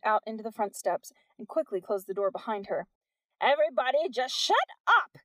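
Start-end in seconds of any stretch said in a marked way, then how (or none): 3.4-5.2 loud, high yell to silence the crowd; shout. A narrator's reading. Missus Wack shouting down the angry crowd. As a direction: shut the mob up. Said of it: "she shouted".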